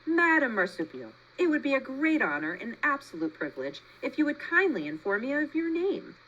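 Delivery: trying hard to sound friendly; friendliest voice. A narrator's reading. Roz politely asks the possum's name, stiffly friendly. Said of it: "in the friendliest voice she could muster".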